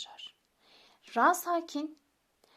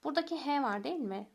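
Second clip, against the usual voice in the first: ordinary delivery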